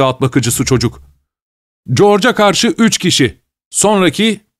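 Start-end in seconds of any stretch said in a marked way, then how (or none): 1.9-4.5 high-pitched, lively tally of the chase; excited onlooker. Acted. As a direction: call the race in a raised pitch. Stable boy calls out the race.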